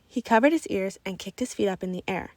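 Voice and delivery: plain reading voice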